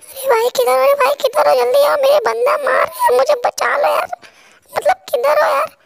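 put-on high, loud, pleading girlish voice; panicky teammate. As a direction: squeal for help like a scared teammate in a firefight.

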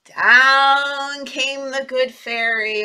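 very slow, drawn-out story line; dramatic storytelling. Acted. Teacher telling the fairy's arrival to children. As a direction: stretch it out slowly.

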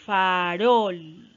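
"Farol" slowly broken into two long syllables; split into syllables. Shown as a demonstration. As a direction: stretch out "Farol", loud and deliberate. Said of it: "Vamos con farol".

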